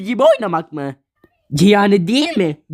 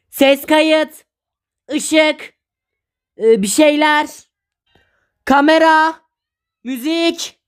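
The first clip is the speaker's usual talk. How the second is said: calling out cues; 6 semitones higher, slower than usual. Acted. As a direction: shout the cues across the set. Director calling set cues.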